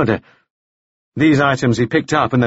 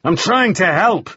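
higher, heated insistence; furious; 7 semitones higher than usual. A narrator's reading. Man repeating to the woman his offer of help, furious. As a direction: push the anger up in pitch, stop short of shouting. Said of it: "Pritchard said furiously".

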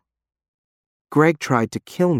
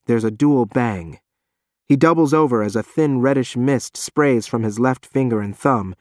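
ordinary delivery, nothing marked

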